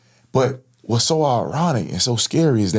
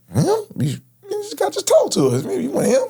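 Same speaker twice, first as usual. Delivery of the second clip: mock high voice. Retelling urging partner at the victim.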